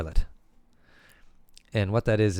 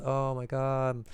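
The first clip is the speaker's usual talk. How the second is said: higher, softer mock complaint; whining daughter; 4 semitones higher, 6 dB quieter than usual. Daughter finds brother in the bathroom, mock whining. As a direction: lightly play a put-upon daughter.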